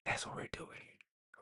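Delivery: hushed, voiceless repeat; barely audible whisper. Pretend. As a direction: whisper it very softly like an echo of yourself. Repeats own line in a whisper.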